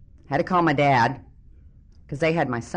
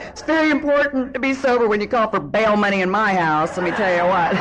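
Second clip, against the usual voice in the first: loud, higher, hammed-up indignation; indignant retort; 8 semitones higher, 7 dB louder than usual. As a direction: holler back at the jail lady, insistent. Retold indignant retort to jail lady.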